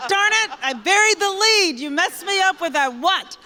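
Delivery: kicking herself for botching the news; laughing frustration at fumbling the big announcement; flustered self-scolding. Retold or imitated. Retelling her own flustered reaction.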